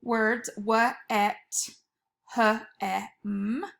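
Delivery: each sound pulled apart slowly; teacher segmenting lag and gas; sounding out letters. Shown as a demonstration. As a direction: stretch out every sound for the class. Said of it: "Wet and hem are the e".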